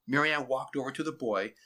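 usual speaking voice

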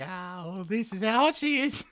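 hammed-up lovestruck gushing; mock swooning woman. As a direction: gush like a smitten woman. Mocking Shurpanakha's gushing description.

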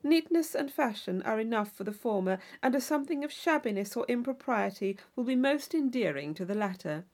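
ordinary delivery

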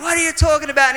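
high, hostile shout; angry yell. Retold or imitated. Rough-looking older man heckling the preacher, angry high yell. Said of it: "he's yelling and he's, he's swearing".

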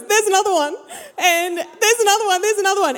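high-pitched mock alarm; alarmed friend.

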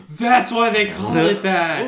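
gasping, high, loud astonishment; mock amazement. Real, not acted. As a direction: gasp in fake shock.

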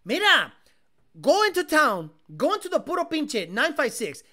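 hammed-up boss giving orders in Spanglish; mock cartel boss. Streamer playing a cartel boss ordering a truck, mock bossy.

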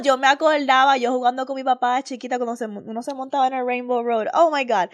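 ordinary delivery

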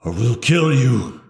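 slow monster threat; menacing werewolf. Acted. Wolf threatens Tina if she stays, menacing creature voice. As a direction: warn them off as a wolf creature.

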